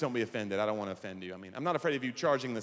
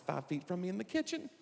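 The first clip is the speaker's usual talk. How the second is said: usual speaking voice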